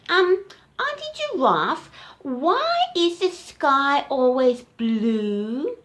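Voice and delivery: high, slow questioning calf voice; little elephant voice. Acted. Little elephant asking Auntie Giraffe a question, high and slow. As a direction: ask shyly and slowly like a small elephant.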